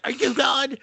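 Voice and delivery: very high, strained taunt; play-acted yelling match; mock monster yell. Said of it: "He's just like".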